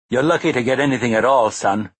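usual speaking voice

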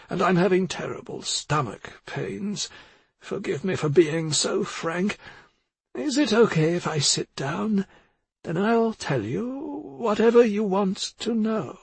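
put-on high, slow old woman's voice; pleading old woman. A narrator's reading.

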